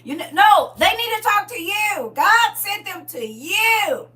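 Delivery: high, loud, slowing insistence; a mother refusing others' problems; indignant shout. Acted. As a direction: throw it back at them hard. Arguing back to God's message.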